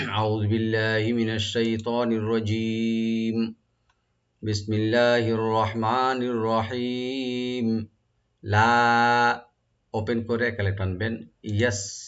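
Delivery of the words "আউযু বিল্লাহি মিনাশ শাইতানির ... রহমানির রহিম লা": slow, drawn-out melodic tajweed chant; Quran recitation. Shown as a demonstration. Teacher begins the recitation for students, slow and chanted. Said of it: "এখন right দিয়ে পড়ার চেষ্টা করব".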